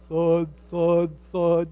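heavy tortoise steps, plodding; plodding thud. Retold or imitated. Tortoise footsteps imitated.

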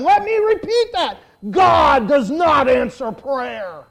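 loud, high imitation of a preacher's shout; booming, drawn-out proclamation; emphatic repetition. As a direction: thunder it out slowly, as a quote repeated for shock. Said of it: "Leonard Ravenhill repeated himself, and he said a second time".

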